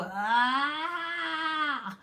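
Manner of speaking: long rising high wail; high, exasperated cry; mock scream. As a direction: let out a drawn-out comic scream. Mock scream at three under two.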